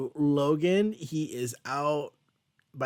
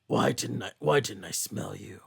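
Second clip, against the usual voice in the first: lower, gruff Wolverine voice; 6 semitones lower, 3 dB quieter than usual. Retold or imitated. Podcaster voicing Wolverine questioning Sarah, lower gruff growl. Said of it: "Wolverine's just like".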